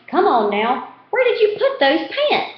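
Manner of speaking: high-pitched exasperated aunt calling; hollering aunt. A narrator's reading. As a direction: scold him from across the house. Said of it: "Aunt Minnie hollering at the little boy".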